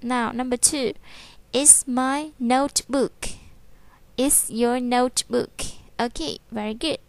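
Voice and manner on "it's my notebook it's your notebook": slow drill reading. Shown as a demonstration. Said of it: "teacher drills".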